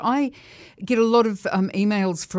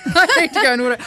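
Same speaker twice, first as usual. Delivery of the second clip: laughing, fast and loud; 7 semitones higher, 8 dB louder, faster than usual. Real, not acted. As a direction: laugh through the words, hurrying. Laughing, rushing off to order.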